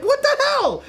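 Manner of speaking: shocked. Retold or imitated. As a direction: squeak it out in disbelief. Shocked at dad's reply.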